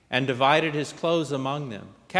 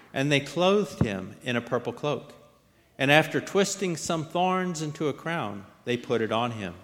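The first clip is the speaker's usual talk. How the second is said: usual speaking voice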